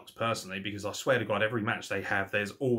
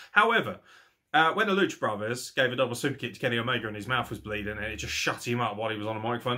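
usual speaking voice

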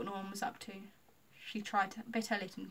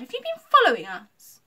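loud, high, startled question; indignant high exclamation; cried out; 8 semitones higher, 17 dB louder than usual. A narrator's reading. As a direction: cry it out accusingly. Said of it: "Lucy cried".

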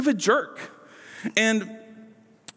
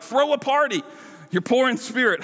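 higher, incredulous mock celebration; mock party cheer; 6 semitones higher than usual. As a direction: cheer it as if absurdly good news. Mocking a cheerful translation of the verse.

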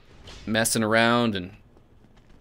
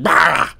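jump-scare shout; 5 semitones higher, 8 dB louder than usual. Retold or imitated. Imitating alien jump scare.

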